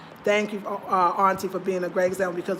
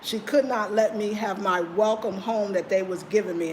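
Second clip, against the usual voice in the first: normal talking voice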